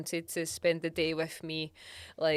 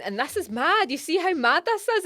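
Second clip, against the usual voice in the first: higher, louder self-imitation; hammed-up astonishment, pitched up and loud; excited catchphrase; 11 semitones higher, 7 dB louder than usual. Imitates own video catchphrase.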